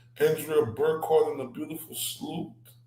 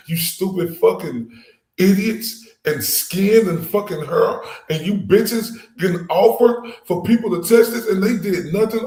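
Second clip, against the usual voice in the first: raised, louder angry tirade; furious rant; 3 semitones higher, 5 dB louder than usual. Real, not acted. Ranting at investigators, furious raised voice. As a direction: lash out at the investigators.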